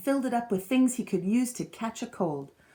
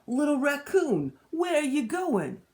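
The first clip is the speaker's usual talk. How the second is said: slow, louder big-brother call; 7 semitones higher, 4 dB louder than usual. A narrator's reading. Big brother calling to Little Raccoon, slow and louder. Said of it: "big brother called".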